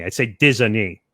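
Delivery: ordinary delivery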